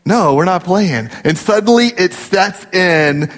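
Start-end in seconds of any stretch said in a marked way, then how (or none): none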